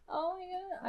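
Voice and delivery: high, soft, overwhelmed oh my God; thrilled gasp. Real, not acted. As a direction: breathe it out, too moved for words. Overwhelmed opening gift box.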